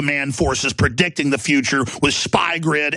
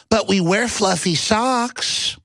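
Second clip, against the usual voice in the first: higher, slow, sugary innocent voice; mock tech executive; 6 semitones higher, much slower than usual. Mocking a Google president with a higher, slow, innocent voice. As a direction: play the harmless exec dodging questions.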